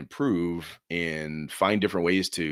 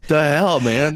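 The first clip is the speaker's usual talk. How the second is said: louder, higher, fed up "the hell, man?"; mock exasperated outburst; 7 semitones higher, 10 dB louder, much slower than usual. Mocking a bored player at the table, loud exasperated outburst. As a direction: throw it out like a baffled player.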